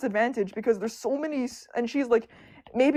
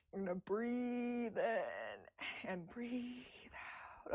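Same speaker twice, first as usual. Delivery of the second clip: slow, very soft self-calming; calming breath; 2 semitones lower, 16 dB quieter, much slower than usual. Real, not acted. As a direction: talk yourself down slowly.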